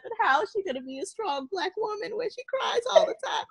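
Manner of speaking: a mother's teary complaint rising in pitch; tearful plea. Retold or imitated. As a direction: pour out the worries through tears. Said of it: "I'm like".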